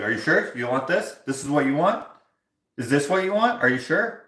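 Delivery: mock-condescending barber, questions tilting upward. Barber questions the customer's every choice, mockingly condescending. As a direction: imitate him doubting the customer, each question rising. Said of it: "very condescendingly".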